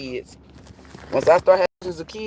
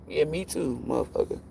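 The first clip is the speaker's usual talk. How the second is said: usual speaking voice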